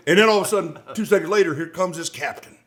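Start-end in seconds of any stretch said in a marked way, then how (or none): none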